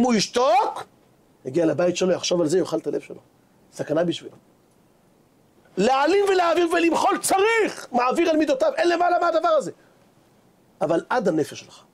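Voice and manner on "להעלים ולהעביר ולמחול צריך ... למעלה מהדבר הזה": preaching at full force. Acted. Preaches the virtue of forgiving. (דרשה בלהט, בקול גבוה ואיטי)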